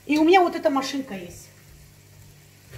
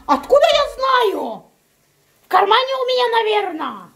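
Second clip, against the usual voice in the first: mocking husband; 8 semitones higher, 4 dB louder than usual. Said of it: "он мне таким этим жаргоном".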